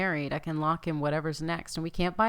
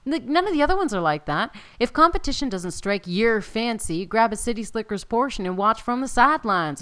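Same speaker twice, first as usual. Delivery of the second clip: louder, bouncy ad-style read; announcer read; 3 semitones higher, 5 dB louder than usual. Acted. Gamer reading the festival card aloud, hammed-up announcer. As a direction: pitch the festival like a town crier.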